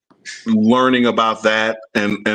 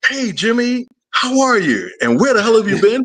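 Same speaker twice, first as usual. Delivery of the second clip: mock excited greeting; 11 semitones higher than usual. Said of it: "my initial first question was gonna be".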